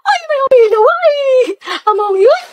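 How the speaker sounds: shrill squeal. Acted. Excited squeal at classmates.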